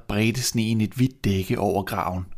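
ordinary delivery

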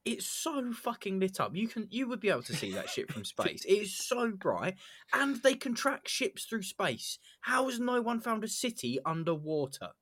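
can't believe how bright it is; incredulous. Real, not acted. Incredulous rant about Gungan City.

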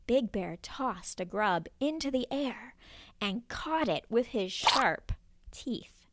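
ordinary delivery

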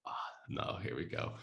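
quiet, low aside; hushed dread. Retold or imitated. Dog owner seeing an angry stranger coming, hushed dread. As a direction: mutter it under your breath, sinking.

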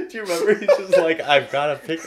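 loud and high, tripping over words through laughter; laughing. Real, not acted. Reminding co-host of the picnic scene, laughing as they talk. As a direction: retell it while cracking up.

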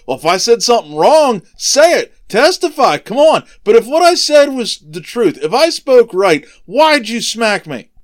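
loud, high-pitched argumentative retort; heated acted protest, each phrase punched; indignant challenge. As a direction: act out demanding a reason for the blow. Jesus protests being hit.

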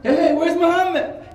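loud, high mock-startled shout; suddenly high and loud, hammed-up panic; retold alarmed call. Man turns, calls for missing friend.

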